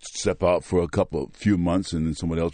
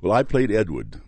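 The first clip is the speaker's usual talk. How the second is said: normal talking voice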